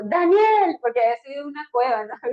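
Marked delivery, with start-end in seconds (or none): 0.0-0.8 shouting the name loud and high; calling out. Retold or imitated. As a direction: call him from the cave's mouth. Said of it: "me imagino que hasta le dijo". (gritar el nombre fuerte y agudo)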